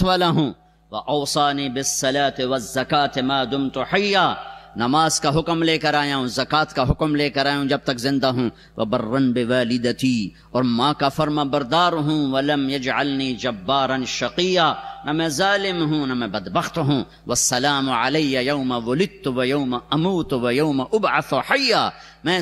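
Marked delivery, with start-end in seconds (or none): none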